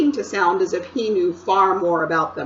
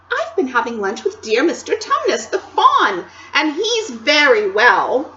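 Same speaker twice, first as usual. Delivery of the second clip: happy Lucy; 3 semitones higher, 8 dB louder than usual. Acted. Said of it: "too happy and excited".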